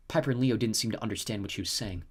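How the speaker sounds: usual speaking voice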